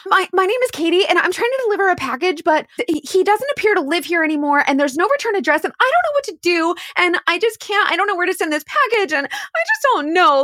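acted panic, high and rushed; frantic, dramatic. Fake courier pleading with the mama about a package, acted frantic. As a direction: pour it out in a hammed-up fluster. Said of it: "So frantically, maybe a little dramatically".

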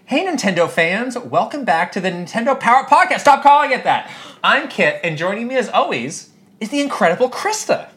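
upbeat radio-host delivery; podcast host read. Acted. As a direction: read it like a cheery show host. Reading a podcast script aloud.

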